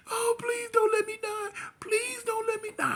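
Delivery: very high crying plea; begging. Retold or imitated. Girlfriend looking up begging not to die, high crying. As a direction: beg for your life.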